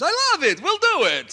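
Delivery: put-on a loud, high, botched Italian accent; Stefano with Italian accent turning Russian. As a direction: belt it out as an Italian boss, accent drifting Russian. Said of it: "I always wind up with Russian instead of Italian".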